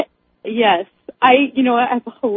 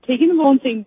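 normal talking voice

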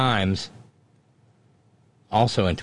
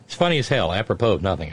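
ordinary delivery

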